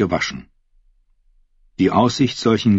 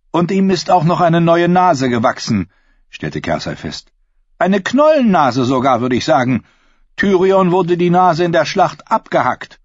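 higher, louder sneering queen voice; mock them with royal contempt; scornful queen; 10 semitones higher, 4 dB louder than usual. A narrator's reading. Queen mocking the fools.

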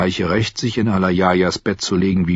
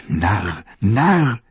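ordinary delivery, nothing marked

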